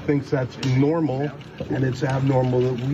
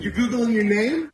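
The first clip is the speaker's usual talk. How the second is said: high, heated taunt across a room; yelling across the room; 8 semitones higher than usual. Real, not acted. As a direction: call out across the room at him. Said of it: "[name] is yelling across".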